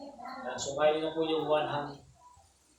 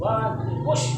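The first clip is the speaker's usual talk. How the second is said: loud, raised-pitch count; counting cue; 5 semitones higher, 7 dB louder, much slower than usual. Real, not acted. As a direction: count firmly up to the push. Therapist counts patient up.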